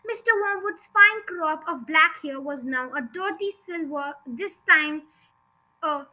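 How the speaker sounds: ordinary delivery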